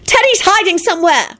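high, anxious complaint; high-pitched fretting; fretful. A narrator's reading. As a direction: report trouble in a fluster. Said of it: "told George fretfully".

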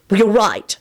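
a touch of anger in the admission; angry. A narrator's reading. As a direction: concede it crossly. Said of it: "he said angrily".

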